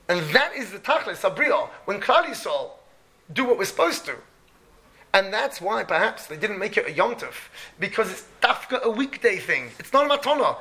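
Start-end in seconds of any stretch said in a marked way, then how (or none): none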